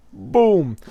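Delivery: booming sound effect, held long; imitated explosion boom. Storyteller voices the transformation blast, a big drawn boom.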